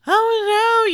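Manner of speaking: high, loud anguished oh no; a mother's retold wail, high and loud; panicked cry. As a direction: cry out in sudden shock and dread. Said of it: "I just freaked out".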